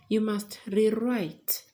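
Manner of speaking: "rewrite" said slowly and loud, stress on the second syllable, re-WRITE; slow verb re-WRITE. Shown as a demonstration. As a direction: lean on the WRITE of "rewrite", drawn out. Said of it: "is a verb".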